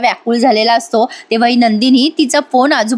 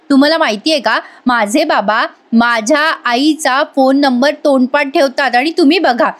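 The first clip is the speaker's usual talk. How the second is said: ordinary delivery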